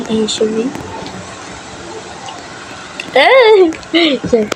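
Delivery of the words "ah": sharp high loud shriek of fright; startled scream. Real, not acted. Caught by the seeker, sudden frightened shriek. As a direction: shriek as the seeker finds you. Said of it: "nakabahan ako".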